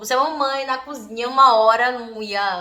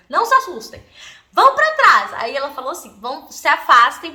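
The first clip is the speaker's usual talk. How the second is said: commanding mom; 3 semitones higher, 8 dB louder than usual. Retold or imitated. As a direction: clear the kids away firmly. Mom ordering kids back.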